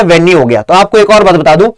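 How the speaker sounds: ordinary talk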